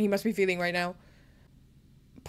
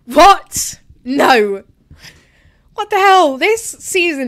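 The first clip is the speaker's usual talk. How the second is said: high, loud cry of disbelief; can't believe what you're seeing; stunned disbelief; 5 semitones higher, 7 dB louder, much slower than usual. Real, not acted. Ferrari's surprise win about to happen.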